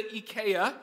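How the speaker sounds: ikea said ee-KAY-uh; Dutch pronunciation. Shown as a demonstration. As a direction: pronounce ikea as the Dutch do, "ee" first. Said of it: "over there they pronounce it ikea".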